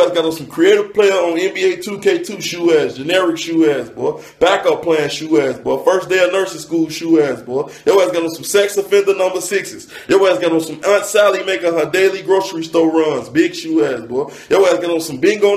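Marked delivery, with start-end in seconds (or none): none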